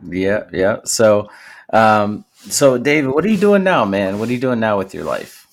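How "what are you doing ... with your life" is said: ordinary talk, nothing marked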